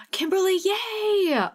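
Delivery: gasp, then a very high squeal of the name; gasping cheer. Real, not acted. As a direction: cheer her like she just won.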